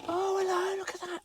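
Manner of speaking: very high, squeaky coo of delight; delighted squeal. Real, not acted. Cook cooing at the pie he just made, high squeal. Admiring the cooked cottage pie.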